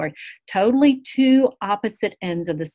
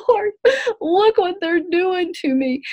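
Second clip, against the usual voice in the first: high, crying plea to the Lord; a high, tearful cry of complaint to God; 13 semitones higher, 5 dB louder than usual. Retold or imitated. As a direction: wail it out like the weeping prophet in the pit. Weeping prophet complains from pit.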